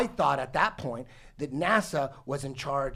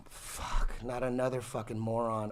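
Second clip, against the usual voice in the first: exasperated dismay; 5 semitones lower, 6 dB quieter than usual. Retold or imitated. Retells dismay at second believer.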